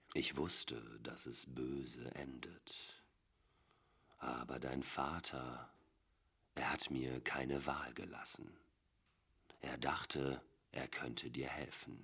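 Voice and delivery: slow, hushed half-whisper; eerie whisper. A narrator's reading. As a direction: drift off into a quiet murmur. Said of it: "noch immer in der Nathan vage bekannten Melodie".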